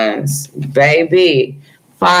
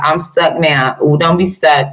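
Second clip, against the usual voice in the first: normal talking voice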